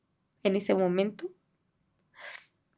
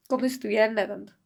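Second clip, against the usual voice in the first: usual speaking voice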